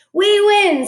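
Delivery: high loud triumphant scream; gleeful scream. A narrator's reading. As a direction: crow over the win. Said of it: "screamed the guests".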